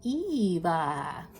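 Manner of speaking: drawn-out cartoon robot name call; Wall-E. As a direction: imitate the movie robot, stretching the name. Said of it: "like, from Wall-E".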